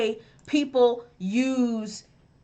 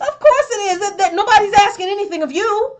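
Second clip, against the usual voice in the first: higher, louder scornful retort; mock exasperation; 9 semitones higher, 4 dB louder than usual. Co-host answering online commenters nobody asked, high mock exasperation. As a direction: shoot it back in high, incredulous dismissal.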